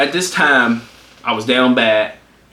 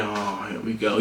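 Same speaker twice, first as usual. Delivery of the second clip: low, soft grumble; resigned dread; 5 semitones lower, 11 dB quieter than usual. Retold or imitated. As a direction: grumble it quietly to yourself. Imagined reaction to being called.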